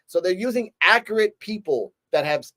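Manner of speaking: ordinary delivery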